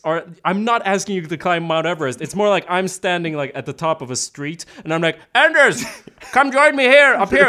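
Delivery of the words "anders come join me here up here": high, loud mock holler; hammed-up, high-pitched shout; calling out. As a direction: call a friend up the street. Calls friend from top of street.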